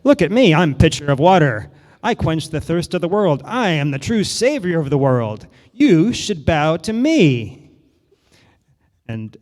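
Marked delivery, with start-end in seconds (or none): 0.0-7.7 loud, haughty declaration; loud, haughty pitcher boasting to the candle; pompous boast. Acted. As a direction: puff up and lord it over Candle.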